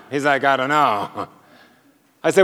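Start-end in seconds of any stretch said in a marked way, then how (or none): 0.4-1.3 clueless shrug. Retold or imitated. Clueless man answering every question.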